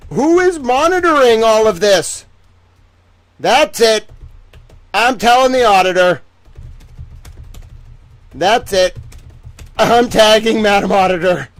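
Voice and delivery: loud, high exasperated outburst; outraged rant. Real, not acted. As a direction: vent your outrage at the screen. Outraged rant about oversight.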